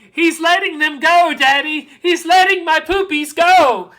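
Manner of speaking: put-on very high, loud child's cry of joy; elated little girl. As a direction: shout it to Daddy, overjoyed. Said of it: "She's giggling now".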